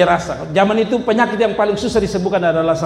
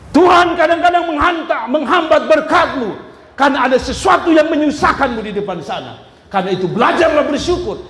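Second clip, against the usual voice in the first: loud, high preacher's exhortation; fervent preaching; 10 semitones higher, 4 dB louder than usual. Acted. Preacher exhorting the congregation to be thankful, fervent preaching. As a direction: drive the lesson home to the congregation.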